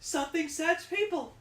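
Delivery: high, hammy English dub line; cheesy dubbed anime shout. Pretend. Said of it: "if she was dubbed".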